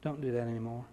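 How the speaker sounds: a kind but firm word; gentle warning. Retold or imitated. Judge gently warning the teenager, low and soft. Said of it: "And he said".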